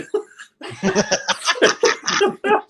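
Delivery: long high cackle; real high laughter. Real, not acted. Laughing at own story.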